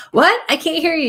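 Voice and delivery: high, loud, laughing "What?"; amused high exclamation. Real, not acted. Medium telling the muted sitter she can't be heard, high and laughing. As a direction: call out in amused surprise.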